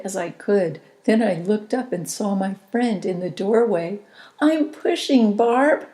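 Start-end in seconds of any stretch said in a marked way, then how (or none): 4.4-5.9 high, loud, joyful call; gleeful cry. A narrator's reading. Mother calls gleefully to her friend in doorway. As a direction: shout it out with glee mid-labor. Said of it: "my cry was gleeful".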